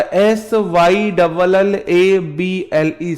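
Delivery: each letter slow and clear; slow spelling. Shown as a demonstration. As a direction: spell it out for the class. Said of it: "वो syllable होता है". (धीरे-धीरे साफ़ spelling)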